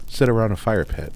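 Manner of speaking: ordinary delivery, nothing marked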